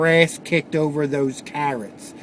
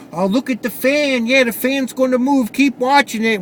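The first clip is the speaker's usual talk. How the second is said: coaxing parent; 8 semitones higher, 3 dB louder than usual. Retold or imitated. Coaxing daughter to open mouth.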